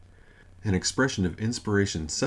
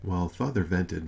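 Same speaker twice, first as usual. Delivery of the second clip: ordinary delivery, nothing marked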